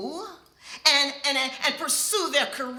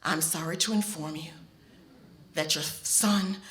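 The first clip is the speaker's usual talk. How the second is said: hospital caller breaking the news softly; somber; 8 semitones lower, 6 dB quieter than usual. Retold or imitated. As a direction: deliver the news gently. Voicing bad news call.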